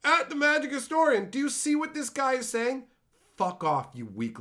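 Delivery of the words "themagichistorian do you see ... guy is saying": high, loud mocking tattletale; mock tattling commenter. Commenter tagging him to report someone, mock alarmed. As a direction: mimic an alarmed tattler, pitched way up. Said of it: "people would do it in my comment section".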